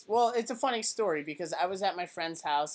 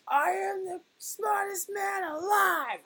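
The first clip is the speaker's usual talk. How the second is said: much higher, slow, dopey boast; Billy Madison quote; slower than usual. Retold or imitated. Fan quoting Billy Madison to friends, in a high, slow dopey voice. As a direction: squeak it out slowly like a proud fool. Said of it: "I do the".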